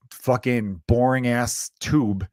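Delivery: ordinary talk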